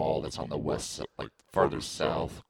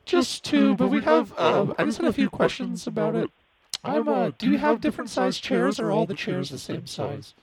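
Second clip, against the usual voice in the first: high squeaky woman on the phone; falsetto lady voice; 3 dB louder than usual. Acted. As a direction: play a sweet nervous wife calling. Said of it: "this is Sally".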